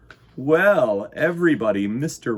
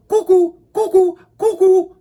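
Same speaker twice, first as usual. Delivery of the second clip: high loud cuckoo whistle; cuckoo call; 13 dB louder than usual. A narrator's reading. Reader playing the cuckoo clock, high and loud. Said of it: "whistled the cuckoo clock".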